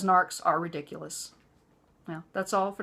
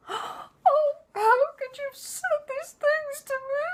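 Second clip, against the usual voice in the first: high, wailing fake sob; mock crying; slower than usual. Mother-in-law playing victim, in a mock crying wail. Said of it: "She just shrivels".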